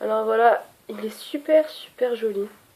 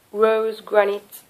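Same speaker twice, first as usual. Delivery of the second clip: slow, drawn-out English accent on a shade name; much slower than usual. Shown as a demonstration. Vlogger reads lipstick shade name in a slow English accent. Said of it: "Toujours que je fasse un accent anglais".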